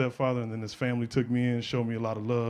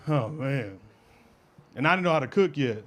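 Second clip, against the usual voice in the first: ordinary delivery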